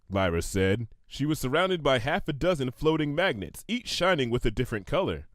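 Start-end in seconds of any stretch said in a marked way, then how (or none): none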